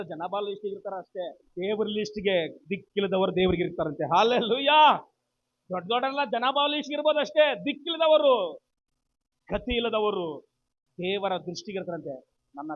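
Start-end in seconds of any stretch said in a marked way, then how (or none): 4.2-8.6 loud, high hallelujah to the congregation; rousing call. Real, not acted. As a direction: call it out to rouse the whole congregation. Preacher calls out to congregation. (ಸಭೆಯನ್ನು ಹುರಿದುಂಬಿಸುವ ಜೋರಾದ ಕೂಗು)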